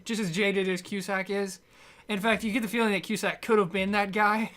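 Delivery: normal talking voice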